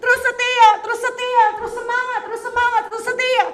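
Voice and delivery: loud, high, rousing preacher; fiery preaching call; preaching at full force. Acted.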